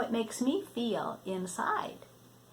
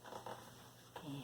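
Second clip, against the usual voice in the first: whisper; 4 semitones lower, 25 dB quieter, much slower than usual. Real, not acted. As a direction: hush a quiet yes to a little one. Grandmother answers child softly.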